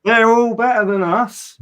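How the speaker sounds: high, slow, incredulous protest; exasperated retort. Retold or imitated. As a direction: spell it out to a clueless heckler. Acts out retort to heckler.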